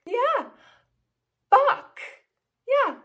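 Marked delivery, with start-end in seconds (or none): 1.5-2.0 high, loud cluck; short sharp hen's BUCK; chicken cluck. Acted. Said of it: "A chicken says".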